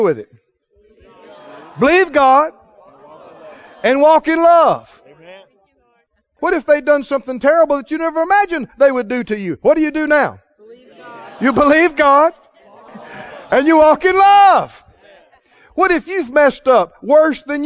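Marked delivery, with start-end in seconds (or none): 1.8-5.0 emphatic preaching. Real, not acted. Preacher answers how to deal with it, emphatic. Preacher building a rousing refrain. 11.4-14.8 raised, ringing refrain, building louder; emphatic preaching. Real, not acted. Preacher answers what to do now, building emphatically.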